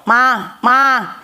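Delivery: ma as a single syllable, repeated; shortened mamá. Retold or imitated. Mother imitating her child's call, shortened mamá. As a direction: call ma with the second syllable missing. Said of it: "Más corto".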